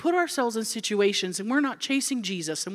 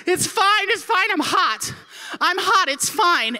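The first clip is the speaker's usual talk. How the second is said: high, frantic mock reassurance; acted overheated fluster; flustered panic; 8 semitones higher, slower than usual. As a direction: insist you're fine while panicking. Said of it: "sweating and I can't breathe".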